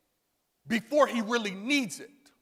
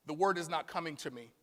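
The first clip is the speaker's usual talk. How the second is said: ordinary talk, nothing marked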